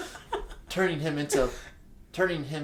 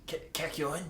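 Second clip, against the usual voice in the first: faint, much softer stammered croak; weak croak; 3 semitones higher, 7 dB quieter than usual. Acted. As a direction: croak the name out feverishly. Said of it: "Jotaro managed to croak out".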